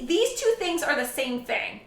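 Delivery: usual speaking voice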